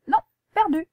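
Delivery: high, loud playful 'perdu'; mock gotcha. Teacher playfully catching the pupil out, high and loud. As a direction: tease the pupil, you fell for it.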